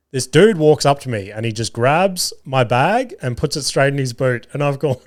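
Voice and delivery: usual speaking voice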